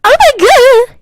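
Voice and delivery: high, loud mock shriek. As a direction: shriek it in a mocking falsetto. Mocking a dramatic character.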